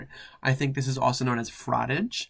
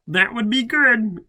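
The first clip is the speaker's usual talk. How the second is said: high, loud mock accent; hammed-up character voice; 10 semitones higher, 8 dB louder than usual. Chris answering Matt about the shower, hammed-up high voice. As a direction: ham up Chris's happy reply. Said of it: "I forgot the accents".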